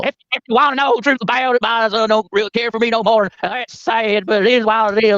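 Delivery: hammed-up, drawn-out generic southern accent; poor southern accent. Needling a debate opponent about family, in a mock southern accent. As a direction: put on a bad southern accent. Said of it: "you do the poorest southern accent".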